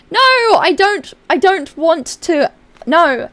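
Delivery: slow, loud, whining refusal; whiny protest. Pretend. Streamer refusing chat's idea, in mock whining protest. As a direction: protest like a stubborn child.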